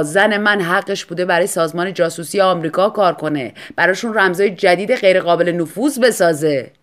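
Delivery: bitter husband. Acted. Husband figure in story.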